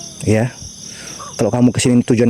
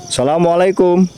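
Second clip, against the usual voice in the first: salam khidmat; 6 semitones higher than usual. Acted. Memberi salam di makam.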